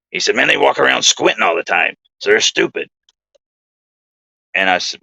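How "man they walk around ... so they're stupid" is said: higher, odd-sounding put-on voice; imitating Bill. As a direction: pitch it up into the buddy's fake-sounding voice. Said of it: "I'm gonna do my best to imitate Bill's voice".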